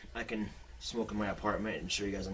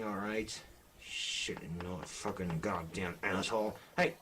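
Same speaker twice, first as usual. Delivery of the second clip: ordinary talk, nothing marked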